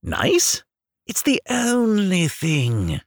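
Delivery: slow, earnest and grave; lightly solemn wise rat. Acted. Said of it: "said the water rat solemnly".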